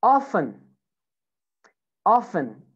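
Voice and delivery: British "Often" with silent T; British pronunciation. Shown as a demonstration. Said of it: "In British accent".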